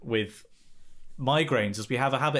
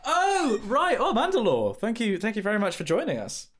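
bright greeting; 7 semitones higher than usual. Acted. High greeting.

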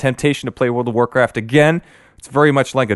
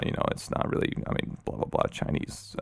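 dismissive mumble; 14 dB quieter than usual. Retold or imitated. Mumbling the company's excuse.

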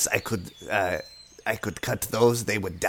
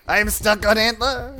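higher, louder, hurting plea; pained distress; 11 semitones higher, 5 dB louder than usual. Acted. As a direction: call for help while pinned. Goblin pinned by elk.

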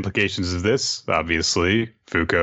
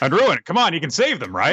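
mock calling out; 9 semitones higher than usual. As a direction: call out to the villain, half joking. Mock calling to anime villain.

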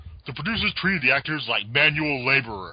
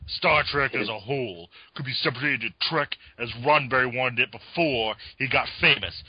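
ordinary delivery

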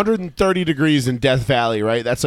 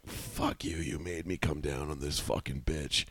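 hoarse whisper; 11 semitones lower, 17 dB quieter, slower than usual. Retold or imitated. Voicing dying son.

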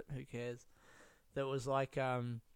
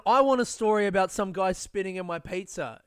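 ordinary talk

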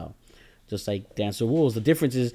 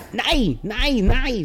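German-accented nai, pitched up; 9 semitones higher, much slower than usual. Shown as a demonstration. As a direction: throw out a simple German "nai". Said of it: "how about that German accent".

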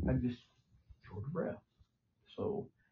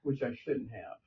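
ordinary delivery

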